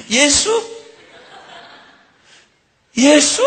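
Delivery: high, loud mother's call; mother searching for her boy; Mary calling out. Acted. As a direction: call for the lost son, high. Preacher voicing Mary calling Jesus.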